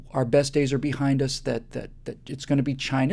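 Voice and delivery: usual speaking voice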